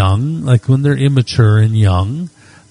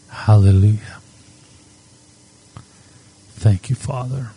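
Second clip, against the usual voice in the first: prayer. Acted. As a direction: pray gently, lingering on each phrase. Pastor leads a quiet prayer.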